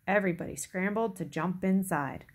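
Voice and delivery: ordinary delivery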